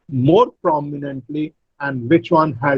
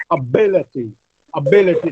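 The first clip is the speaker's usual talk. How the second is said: "ability" said a-BIL-ity; stress on second syllable. Shown as a demonstration. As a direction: lean on BIL in "ability", reduced first vowel. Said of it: "correct pronunciation with the correct stress".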